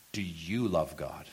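usual speaking voice

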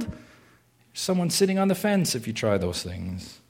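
ordinary delivery

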